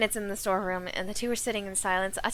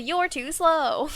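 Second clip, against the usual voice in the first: slow, higher, drawn-out smug taunt; Sonic taunt; 6 semitones higher, 5 dB louder, much slower than usual. Retold or imitated. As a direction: stretch it out, cocky and slow. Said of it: "but it's literally".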